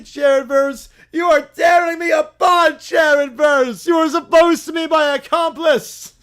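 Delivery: loud, high hammed-up shout of betrayal; furious betrayal. Villain screaming at the chair that betrayed him, furious. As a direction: rage at the traitor chair.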